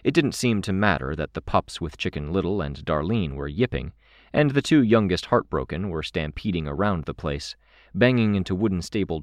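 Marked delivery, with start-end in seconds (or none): none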